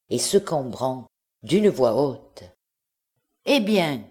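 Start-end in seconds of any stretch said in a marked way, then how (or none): 3.5-4.0 a sharp cry out to the crowd; shouting. A narrator's reading. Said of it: "cria-t-elle".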